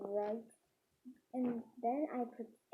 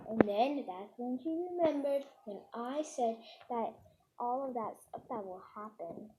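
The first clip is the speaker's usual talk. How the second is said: ordinary delivery, nothing marked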